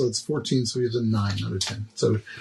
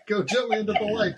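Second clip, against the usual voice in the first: laughing; 8 semitones higher than usual. Retold or imitated. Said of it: "wide-eyed screaming".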